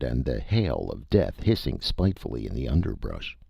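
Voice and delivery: ordinary delivery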